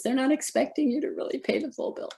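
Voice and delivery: high, breathless whispered secret; confiding whisper. Real, not acted. Telling cancer patients hospitals won't expect full payment, whispered. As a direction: let them in on it in a hush.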